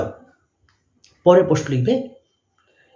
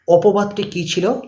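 dictating; slower than usual. Shown as a demonstration. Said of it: "dash দিয়ে লিখবে".

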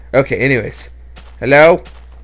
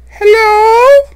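thin ghostly high call; haunt the cave with a ghost voice; eerie falsetto hello; spooky falsetto; 12 dB louder than usual. Pretend.

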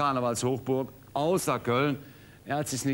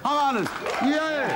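excited; faster than usual. Real, not acted. Host cheering the winning answer.

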